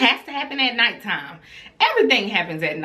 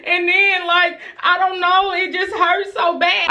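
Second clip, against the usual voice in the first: put-on high whining little girl voice; high, pleading child's whine; whiny child; 9 semitones higher than usual. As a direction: imitate a kid complaining her hair burns. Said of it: "She was like".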